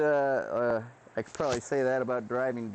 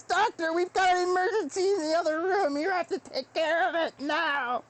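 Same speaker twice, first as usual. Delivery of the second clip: much higher urgent nurse voice; frantic nurse; 13 semitones higher than usual. Acted. Nurse rushing in to call the doctor to an emergency, high panicked voice. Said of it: "the nurse comes rushing in and says".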